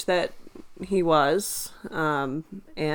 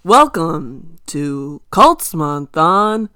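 slow, loud, stretched announcer call; announcer intro; 9 semitones higher, 8 dB louder, much slower than usual. Acted. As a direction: stretch it like a show host.

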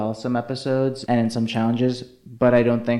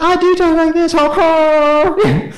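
Laganja; 8 dB louder, slower than usual. Retold or imitated. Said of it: "And she's like".

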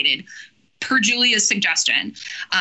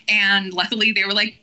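ordinary talk, nothing marked

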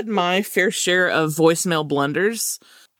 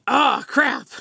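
exclaiming; 9 semitones higher than usual. Retold or imitated. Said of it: "After exclaiming".

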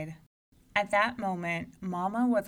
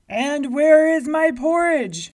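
loud, slow booming giant; giant demanding; 9 semitones higher, 16 dB louder than usual. A narrator's reading. Giant at the door demanding his porridge, booming.

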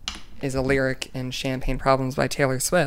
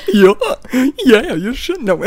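very high, loud squeaky cartoon mouse; Mickey Mouse voice; 9 semitones higher, 8 dB louder than usual. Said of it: "I can do Mickey".

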